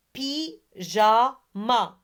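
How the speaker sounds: PYJAMA slowly, split py-ja-ma; three separate beats on "Pyjama"; syllable by syllable. Shown as a demonstration. Teacher has pupils repeat Pyjama, syllable by syllable. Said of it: "Ce sont les nombres de syllabes".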